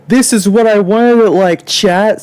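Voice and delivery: high-pitched mock whine; mocking imitation. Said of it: "I'm making fun of him".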